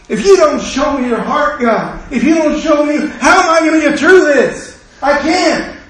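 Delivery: high, loud pleading shout; begging God at the top of the voice; desperate cry to God. Pretend. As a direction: cry out to God as if at the end of your rope. Desperate prayer voiced in sermon.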